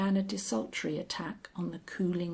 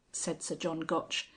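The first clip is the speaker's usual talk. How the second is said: ordinary delivery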